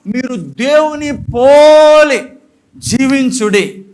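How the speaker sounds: loud, slow, drawn-out proclamation of the verse; preaching shout. Real, not acted. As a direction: thunder the verse out slowly. (నెమ్మదిగా గట్టిగా ప్రకటన)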